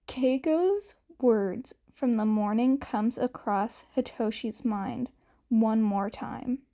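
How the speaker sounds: ordinary delivery, nothing marked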